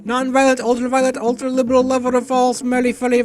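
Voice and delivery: high, fawning list of praise; butter him up endlessly; a servile seller, gushing; flattering merchant. Acted.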